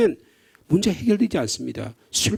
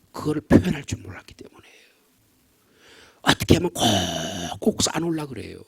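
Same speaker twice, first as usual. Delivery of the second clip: hushed; 5 semitones lower, 4 dB quieter than usual. Acted. Hushed intimate explanation.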